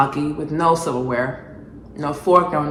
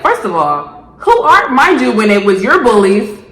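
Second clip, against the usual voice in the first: indignant rant; 3 semitones higher, 6 dB louder than usual. Real, not acted. Outraged rant.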